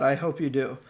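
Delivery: ordinary talk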